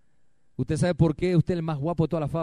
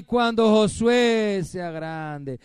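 loud, high and slow, drawn out; building fervor; 5 semitones higher, 6 dB louder, much slower than usual. Shown as a demonstration. Pastor dreaming aloud about the children, loud and slow. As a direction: cry it out with rising joy.

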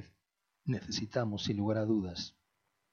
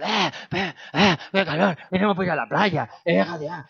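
rowdy neighbours; 5 semitones higher, 7 dB louder than usual. Retold or imitated. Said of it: "otros están".

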